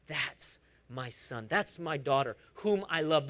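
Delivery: low, soft and slow, like a proud father; tender God voice. Acted. Preacher voicing God affirming his children, low and tender.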